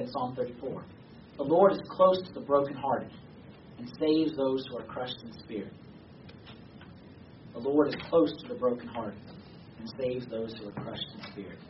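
low, hushed verse reading; solemn scripture reading. Real, not acted. Preacher closing with King David's psalm, solemn and subdued. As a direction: bring it down low and soft for the verse.

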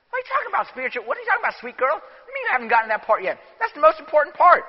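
high, incredulous exclamation, then fast; mock disbelief. Priest reacting to the girl with no faith, mock shocked. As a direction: act stunned at her answer.

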